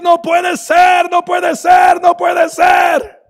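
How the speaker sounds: high, loud and slow, repeating in disbelief; pulling his hair out, shouting; despairing shout. Acted. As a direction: cry it out like a man at his wits' end. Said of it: "Y empezó a decir".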